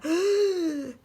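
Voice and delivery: loud, high, sucking gasp for air; a huge desperate intake of breath; loud gasp, re-enacted; imitated big gasp. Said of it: "he took, like, a".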